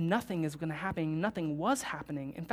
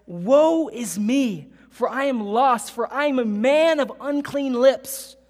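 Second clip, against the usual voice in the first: anguished lament; 4 semitones higher, 10 dB louder, slower than usual. Acted. Isaiah's lament.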